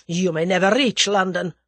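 loud menacing character voice; snarl. A narrator's reading. The Russian threatens his captor in the car, snarling. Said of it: "snarled the other".

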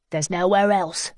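plain reading voice

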